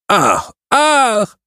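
high, fainting cries of maidens; theatrical faint; swooning. A narrator's reading. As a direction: gasp out high swooning ahs. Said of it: "dlały dziewice".

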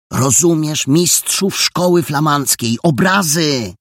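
loud, high, emphatic clarification; exasperated explaining. Acted. Jola explains Flemish masters' paintings, exasperated and emphatic. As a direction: spell it out loudly for someone slow.

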